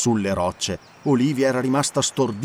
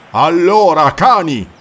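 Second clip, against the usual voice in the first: roared challenge to the pirates, much louder; loud and higher, a fierce roar; 5 semitones higher, 10 dB louder, slower than usual. Acted. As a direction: bellow it like a warrior over a fallen foe. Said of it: "ruggì".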